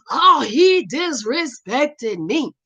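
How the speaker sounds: slow, wavering mock wail; mock wounded pride. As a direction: drag it out like a whiny complainer. Mocks fools killing over disrespect.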